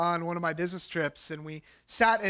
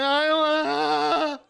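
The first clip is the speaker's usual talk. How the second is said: put-on slow, higher, louder childish I don't wanna; reluctant whine; 7 semitones higher, 5 dB louder, slower than usual. Couple dreading the lobster eggs, slow whine. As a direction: drag it out like a kid refusing food. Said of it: "sitting there going".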